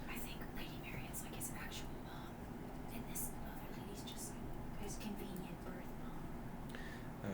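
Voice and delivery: hushed aside to the table; whispered player aside during a tense scene. Real, not acted. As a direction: lean in and whisper the theory.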